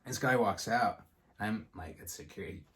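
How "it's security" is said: hushed alarm. Retold or imitated. Thinking security is coming.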